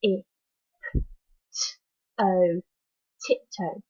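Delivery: t, I, p, t, o split into separate sounds, then blended; sounding out. Shown as a demonstration. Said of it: "Let's have a go".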